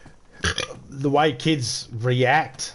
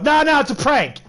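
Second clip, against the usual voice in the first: high, loud, insistent "no, no"; imitating the prank kid; 12 semitones higher, 6 dB louder than usual. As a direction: play the kid brushing it off. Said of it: "And the guy's like".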